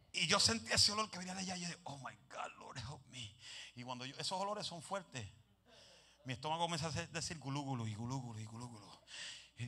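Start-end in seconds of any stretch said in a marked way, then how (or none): 1.8-3.4 soft, lower desperate plea; quiet plea. Retold or imitated. The preacher begs God quietly while smelling the man. 7.1-9.0 stomach gurgle noise. Retold or imitated. Imitating his stomach noise.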